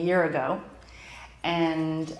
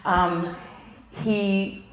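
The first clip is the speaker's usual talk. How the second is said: ordinary talk, nothing marked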